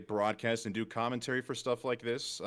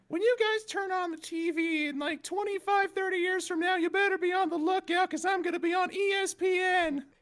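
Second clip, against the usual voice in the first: put-on much higher, childlike and excited; squeaky little kid boasting; high-pitched kid voice; 3 dB louder than usual. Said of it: "with a high-pitched voice at the time".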